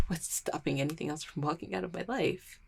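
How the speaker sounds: breathless scared whisper; a whispered crack in the composure; frightened whisper. Acted. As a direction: let the fear slip out under your breath. Panic breaking through denial.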